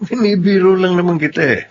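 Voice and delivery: high, laughing through the words; chuckling, playful teasing; laughing tease. Acted. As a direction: laugh it off while teasing your wife. Husband laughs off teasing wife.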